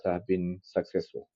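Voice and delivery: ordinary delivery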